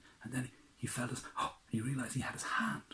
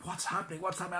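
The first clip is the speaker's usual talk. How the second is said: higher, alarmed questions; panicked; 9 semitones higher, 4 dB louder, faster than usual. Acted. Prince in the burning courtyard, panicked and high. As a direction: panic as the flames rise.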